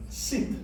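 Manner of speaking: sit with a quick, short "i" vowel; short E sound. Shown as a demonstration. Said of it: "American pronunciation".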